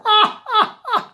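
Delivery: very high, loud staged cackling; jeering laugh. A narrator's reading. Policeman laughing at Jack before the crowd, derisive. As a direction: carry on laughing at full pitch. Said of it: "he carried on laughing".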